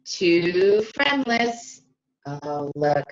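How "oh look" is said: plain reading voice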